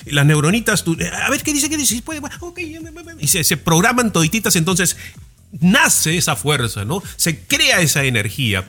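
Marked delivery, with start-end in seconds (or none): 1.2-3.1 high squeaky little brain cells, fading away; tiny neuron voices. Pretend. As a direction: play the neurons as tiny excited voices trailing off.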